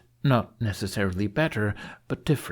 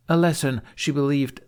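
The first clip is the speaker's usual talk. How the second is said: ordinary delivery, nothing marked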